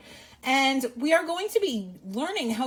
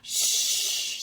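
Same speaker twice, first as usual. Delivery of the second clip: a long whispered shh; shushing bat; 5 dB quieter than usual. Acted. The bat hushes the old lady, whispered. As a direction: hush everyone like the bat. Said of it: "said the bat".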